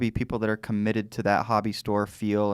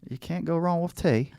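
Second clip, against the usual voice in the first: ordinary delivery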